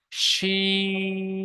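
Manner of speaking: শিন with a thick sh, drawn very long; thick long shin. Shown as a demonstration. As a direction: hold শিন broad and long. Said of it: "শিনের আওয়াজটা মোটা আওয়াজে পড়তে হবে". (মোটা আওয়াজে লম্বা টেনে শিন)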